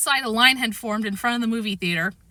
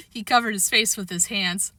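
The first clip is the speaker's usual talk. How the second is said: ordinary delivery, nothing marked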